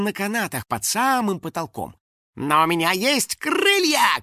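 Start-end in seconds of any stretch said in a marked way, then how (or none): 2.4-4.2 high, drawn-out triumphant call; defiant cry. Acted. Finik leaping onto the pterosaur, crying out. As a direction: cry it out, stretching the words. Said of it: "крикнул Финик".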